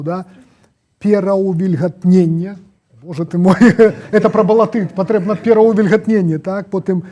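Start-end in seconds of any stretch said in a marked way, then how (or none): 1.0-2.6 loud and slow, every syllable; slow careful tongue twister. Shown as a demonstration. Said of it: "я іх проста скажу". 3.0-6.4 laughing. Real, not acted. Laughing at tricky word.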